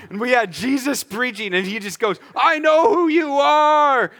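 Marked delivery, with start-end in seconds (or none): 2.4-4.1 high, drawn-out full-force yell; freaking out. Acted. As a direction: yell it like a man possessed. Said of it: "just starts freaking out".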